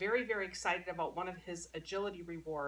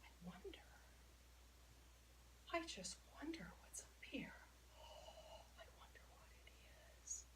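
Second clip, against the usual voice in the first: very soft, suspenseful whisper to a dog; excited whisper; 5 semitones higher, 22 dB quieter, faster than usual. Shown as a demonstration. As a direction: whisper as if wondering at a secret. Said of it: "Whispering gets him excited".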